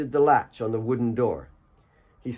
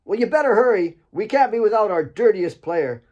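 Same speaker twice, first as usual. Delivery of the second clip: high, loud playful taunt; teasing call; 10 semitones higher, 5 dB louder than usual. Acted. Asif teases Alex from the compound, high and playful. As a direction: rib him across the distance.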